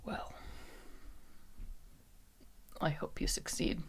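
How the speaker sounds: very soft, barely voiced; faint near-whisper. [name] answering Lou's promise, faint resigned whisper. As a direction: say it under the breath, resigned.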